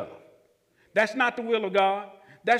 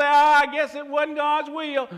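mock resigned doubter; 6 semitones higher, 5 dB louder than usual. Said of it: "change your confession and say".

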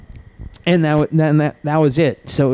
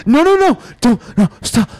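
frantic high cries, much louder; panicked pleading; 8 semitones higher, 5 dB louder than usual. Retold or imitated. Mimicking a dying bunny pleading, frantic and high. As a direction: beg them not to die.

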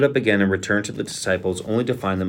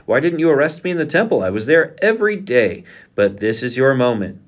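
higher, louder protest; indignant challenge; 5 semitones higher, 6 dB louder than usual. A narrator's reading. Jesus to those arresting him, indignant. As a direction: challenge them forcefully, voice raised.

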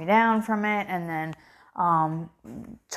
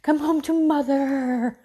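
a lightly voiced, slow, higher "Come home to mother"; mock doting mother; 6 semitones higher than usual. Mother teasing herself as a clingy mom, in mock doting voice. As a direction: play the clingy mom, drawn out. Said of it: "a person who is like".

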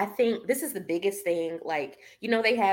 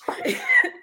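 high burst of laughter; 6 dB louder than usual. Real, not acted. Laughing at own quote.

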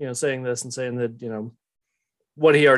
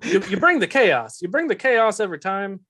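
put-on exasperated boss with a Southern accent, higher; [name]; 5 semitones higher than usual. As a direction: complain in a lilting Southern accent. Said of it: "Southern accent".

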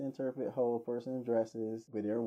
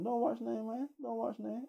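much higher, wheedling street-beggar voice; threatening beggar; 11 semitones higher than usual. Retold or imitated. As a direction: play the pushy beggar, half-menacing.

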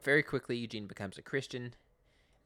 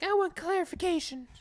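put-on high, loud girlish voice; a mock lady's demand; imitating Katrina; 7 dB louder than usual. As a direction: put on her prim voice. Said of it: "Katrina's like".

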